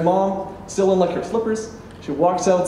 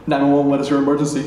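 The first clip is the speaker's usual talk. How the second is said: brisk, even phone-operator patter; 911 dispatcher; 4 semitones lower, much faster than usual. Retold or imitated. Operator answers an emergency call, brisk and routine. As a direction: rattle it off like a dispatcher.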